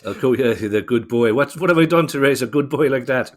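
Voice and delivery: hammed-up Irish accent of a proud mother; mock Irish mammy. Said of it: "sorry, Biden, for the Irish accent".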